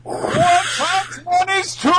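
high, slow crying shout; wailing mock Terrence Howard; Terrence Howard impression. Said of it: "Terrence Howard impersonation".

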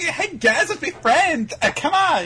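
high-pitched, bubbly mock cheer; happy, energetic girl. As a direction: imitate a relentlessly peppy new friend. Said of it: "so happy and so energetic".

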